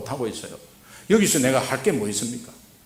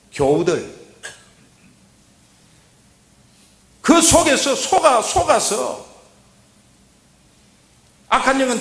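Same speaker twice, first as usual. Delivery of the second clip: loud, higher, driving sermon voice; forceful preaching; 8 semitones higher, 7 dB louder than usual. Acted. Pastor urging the congregation, preaching at full force. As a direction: exhort the flock with full force.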